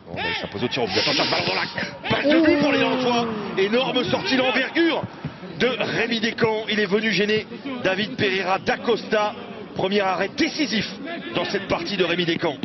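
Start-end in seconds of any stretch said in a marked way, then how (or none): 2.1-12.6 rising, urgent play-by-play; excited sports commentary. Acted. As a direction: call the big save with energy. Play-by-play save call.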